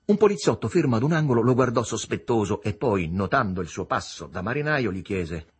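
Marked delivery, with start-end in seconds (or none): none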